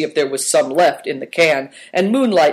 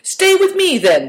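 much higher, louder eager invite; eager offer; 5 dB louder than usual. A narrator's reading. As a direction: urge them to stay with him. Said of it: "the Duke proposed".

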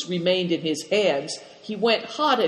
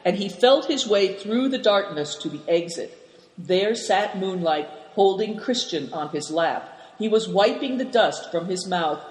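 usual speaking voice

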